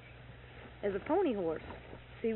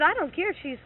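angry retort; 7 semitones higher, 8 dB louder than usual. Retold or imitated. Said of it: "I said".

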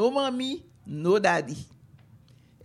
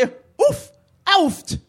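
higher, louder sudden ouch; American pain cry; 6 semitones higher, 6 dB louder than usual. Shown as a demonstration. Teaching children how Americans cry when burned, sharp yelp. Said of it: "if you're an American".

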